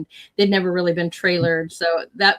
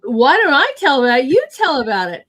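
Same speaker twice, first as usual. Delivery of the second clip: playful mock echo, higher and louder; teasing echo; 7 semitones higher, 8 dB louder than usual. Real, not acted. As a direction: toss the question right back, teasing. Teasing echo of friend's question.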